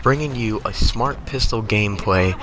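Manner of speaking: usual speaking voice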